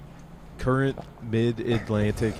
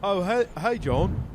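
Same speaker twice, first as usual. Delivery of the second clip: put-on Cockney accent; 7 semitones higher than usual. Podcaster shows a cohost his exaggerated Cockney accent. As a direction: play a chirpy Cockney in a Guy Ritchie movie. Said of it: "Cockney [name]".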